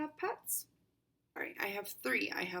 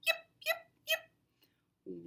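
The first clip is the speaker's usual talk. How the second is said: very high puppy yipping; puppy yips; much slower than usual. Acted. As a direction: yip like a tiny puppy. Puppy noises.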